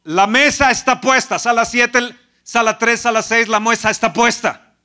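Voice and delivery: loud, driving sermon voice; fervent preaching. Acted. Pastor exhorting the congregation, loud fervent preaching. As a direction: preach it with rising fire.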